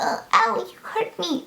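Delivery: high, whiny little ow, mocking a fussy customer; hammed-up fragile client whimper; mocking an overdramatic customer who whines ow; mock whiny complaint. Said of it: "I'm not one of those people that's like".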